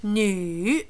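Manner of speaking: nu drawn out, dipping down then up. Shown as a demonstration. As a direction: say nu slowly, falling and then rising. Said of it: "notice this, down and up".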